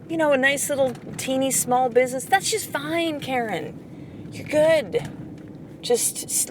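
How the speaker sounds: lizard brain voice. Retold or imitated. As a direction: lull her into playing small. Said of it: "That's what the lizard brain will tell you".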